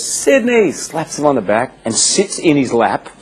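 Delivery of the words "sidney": high, bright call of the name; mock greeting call. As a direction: sing out the name to an old friend. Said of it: "just comes and goes".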